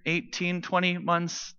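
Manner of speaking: quiet, tentative checking of a number; unsure. Real, not acted. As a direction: say it softly, feeling for the right figure.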